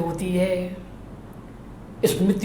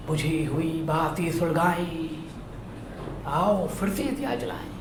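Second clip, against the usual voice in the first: soft, measured poem recitation with long pauses; hushed, solemn verse reading; poem recital tribute; 5 dB quieter than usual. Acted. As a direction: recite it gently as a tribute, swelling midway, sinking almost to a hush. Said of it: "भावांजलि उनकी पंक्तियों से मैं देना चाहता हूं".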